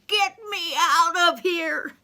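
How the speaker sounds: high, loud, desperate holler; panicked high-pitched yelling. Acted. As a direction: yell up out of the pit for rescue.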